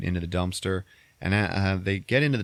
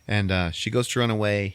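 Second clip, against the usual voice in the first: ordinary talk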